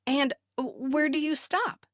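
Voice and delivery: usual speaking voice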